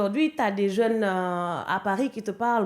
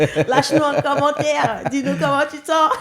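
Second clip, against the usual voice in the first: higher and louder, racing through the sign-off with a laugh; hammed-up outro pitch; 5 semitones higher, 9 dB louder than usual. Guest hamming up the podcast outro, high and loud. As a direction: sell the subscribe plea like a host.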